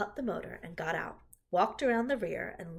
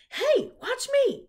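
high, loud, drawn-out yell; attention-grabbing shout, pitched way up; boyish yell; 10 dB louder, slower than usual. A narrator's reading. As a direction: yell like a show-off boy. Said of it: "yelled Avery".

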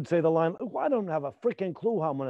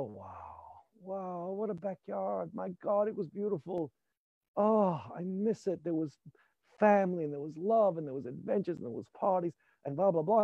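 wistful private memory; 7 dB quieter than usual. Shown as a demonstration. Actor showing a monologue fed by a private memory. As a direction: let the memory feed the line softly. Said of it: "the monologue will come out like this".

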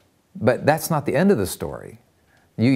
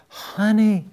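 high, pleading wife; retold desperation; 10 semitones higher than usual. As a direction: act out her desperate appeal, pitched high. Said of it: "looking at him with these just desperation".

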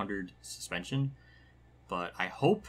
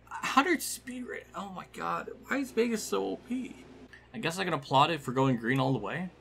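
ordinary talk

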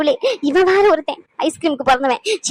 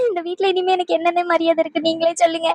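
softer voice breaking into crying; 3 dB quieter than usual. Acted. Hurt sister crying to her brother about respect. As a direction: sob out the question. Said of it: "இப்படி அழுவுறீங்க?".

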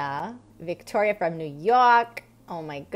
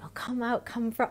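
soft whispered coaxing, slightly higher; gentle wake-up whisper; 4 semitones higher, 6 dB quieter than usual. Retold or imitated. Mother whispering to wake her daughter, soft and coaxing. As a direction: whisper it like coaxing a sleeping child. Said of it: "I was waking her up".

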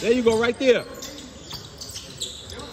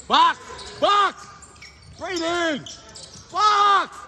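yelling across the court; 9 semitones higher, slower than usual. Real, not acted. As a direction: shout it across a noisy gym. Coach yelling during game at ref.

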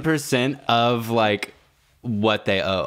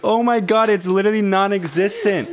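laughing; 11 semitones higher than usual. Real, not acted. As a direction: keep laughing while pleading, pitched way up. Still laughing over numb leg.

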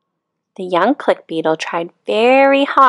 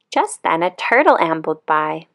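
ordinary delivery

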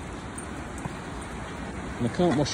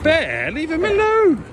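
high, slow, stretched warning call; calling the dog off; 11 semitones higher, 4 dB louder, slower than usual. Real, not acted. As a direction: call Bear off his brother. Telling dog off from afar.

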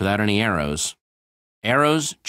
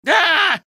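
shout; 6 dB louder than usual. Acted. Startled cry.

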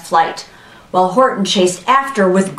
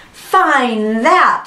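high, drawn-out taunt; scornful storybook bird; sneering; 6 semitones higher than usual. A narrator's reading. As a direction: sneer it with a raised pitch. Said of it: "sneered the bird".

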